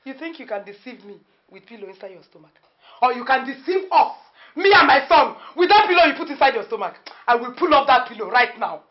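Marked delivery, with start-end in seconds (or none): 3.0-8.8 loud, high, fast accusing rant; angry tirade. Acted. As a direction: tear into her lie. Accusing fake pregnancy.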